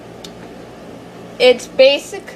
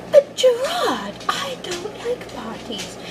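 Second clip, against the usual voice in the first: put-on whiny timid kid; 13 semitones higher, 5 dB quieter, slower than usual. Timid brother voiced.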